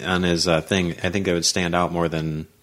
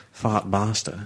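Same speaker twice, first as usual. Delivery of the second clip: put-on softer Scottish-accented take on the name; Fat Bastard; 5 dB quieter, much slower than usual. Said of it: "He's a Scottish guy".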